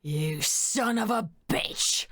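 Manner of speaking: hissed in rage, slow; furious whisper. Acted. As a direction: spit it out in hushed fury. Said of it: "his voice rumbled with hate".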